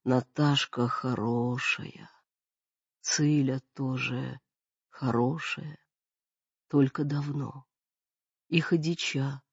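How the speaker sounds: put-on soft, slow, fading old woman; frail dying mother. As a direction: barely get the words out.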